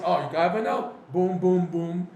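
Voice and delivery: loud, mock-stern God with boom sound effects; loud mock divine rebuke; God scolding. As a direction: knock him down playfully. Acts God knocking him down.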